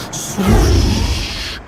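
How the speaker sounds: long airy swoosh; breath-only whoosh; whooshing sound effect. A narrator's reading. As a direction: imitate a rush of wind sweeping past. Narrator imitates the rushing plane.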